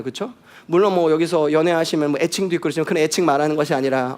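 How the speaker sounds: ordinary talk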